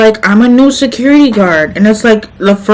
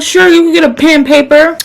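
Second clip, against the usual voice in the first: high sarcastic; 7 semitones higher than usual. Retold or imitated. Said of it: "So I'm like".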